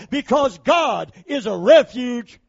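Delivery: slow, loud, high preaching shout. Real, not acted. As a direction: lift it up and shout it slowly.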